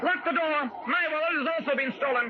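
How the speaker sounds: John Cooley, alarmed. Acted. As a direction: bark the order, then report the theft. John Cooley finds his wallet gone.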